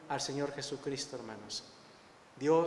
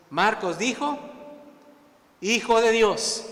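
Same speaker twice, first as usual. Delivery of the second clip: loud, raised-pitch preacher proclaiming scripture; preaching; 7 semitones higher, 9 dB louder than usual. Real, not acted. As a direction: preach it forcefully to the congregation. Preaches at full force.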